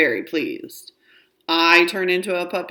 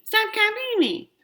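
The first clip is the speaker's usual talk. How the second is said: much higher, slower, whiny parrot of her words; mocking copy. Little sibling mocks sister's words back, squeaky and slow. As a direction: mimic her to annoy her. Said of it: "I copy her".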